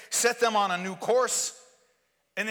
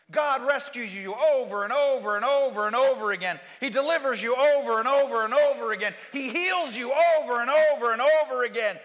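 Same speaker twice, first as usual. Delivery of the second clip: loud, rising pulpit preaching; preacher pressing the congregation, loud; forceful preaching; 4 semitones higher, 5 dB louder than usual. Acted. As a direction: drive it home like a preacher.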